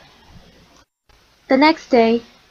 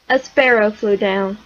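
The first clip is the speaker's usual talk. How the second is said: ordinary delivery, nothing marked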